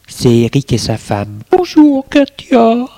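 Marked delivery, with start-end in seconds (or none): 1.5-2.9 high bright greeting voice; Hélène's voice. Acted. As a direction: greet her warmly, pitched up. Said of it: "lui dit Hélène".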